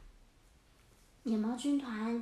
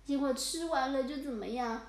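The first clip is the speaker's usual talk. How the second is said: plain reading voice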